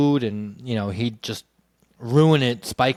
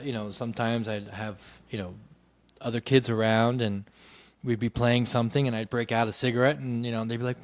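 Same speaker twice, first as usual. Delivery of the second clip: usual speaking voice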